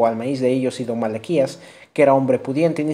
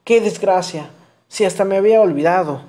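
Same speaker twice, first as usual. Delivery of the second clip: higher, louder lament, voice changed; mother lamenting her son's crime with a touch of tears; mother weeping; 6 semitones higher, 4 dB louder than usual. Acted. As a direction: cry out in mild grief. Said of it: "la madre lloraba".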